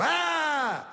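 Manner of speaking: high-pitched, drawn-out "aah"; mock horrified cry. Imagined son finding the body.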